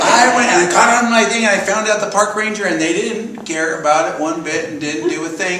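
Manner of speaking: ordinary delivery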